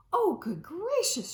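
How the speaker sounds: high, loud gasp of alarm; Emily finding the kitten at the piano, loud gasp; shocked gasp. Acted. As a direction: exclaim it in shock. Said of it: "she gasped".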